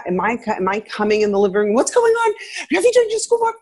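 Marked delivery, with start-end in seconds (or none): 1.7-3.5 much higher, frazzled demanding questions; high tense mock-scolding parent; stressed nagging mom. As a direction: barge in like an overwhelmed mom. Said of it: "If I'm coming into a room like that".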